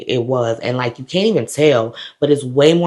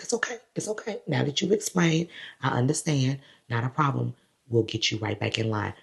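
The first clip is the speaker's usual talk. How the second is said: soft reassurance; 10 dB quieter than usual. Retold or imitated. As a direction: soothe someone who is about to cry. Officer reassures her, near tears.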